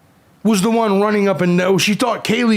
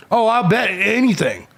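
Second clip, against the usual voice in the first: normal talking voice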